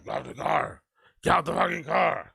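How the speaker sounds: mock superhero voice; Batman. Reactor mocking the storyteller as hero, Batman voice. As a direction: bark it as a parody action hero. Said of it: "like fucking Batman".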